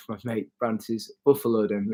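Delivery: ordinary talk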